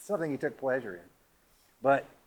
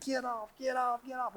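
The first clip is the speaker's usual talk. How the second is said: much higher, frantic aunt crying out; panicked aunt; 11 semitones higher than usual. Retold or imitated. Aunt Bessie yelling at the squirrel on her hat, high and frantic.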